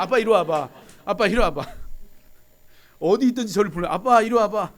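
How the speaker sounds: put-on loud insistent call of a child. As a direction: shout across the house for dad. Son calling dad repeatedly.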